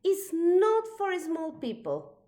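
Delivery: high and loud, scolding a little one; indignant cry. A narrator's reading. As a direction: stamp the point home, loud and high. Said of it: "said Elmore".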